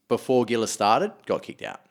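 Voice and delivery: ordinary talk, nothing marked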